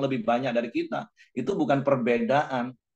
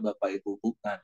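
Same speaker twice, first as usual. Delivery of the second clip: ordinary talk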